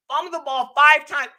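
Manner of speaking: normal talking voice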